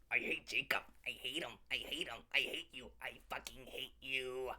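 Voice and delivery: low soft menacing grudge; seething hate. Acted. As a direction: mutter the hatred through your teeth. Seething grudge against Jacob.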